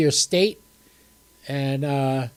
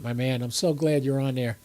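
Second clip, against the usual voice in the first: usual speaking voice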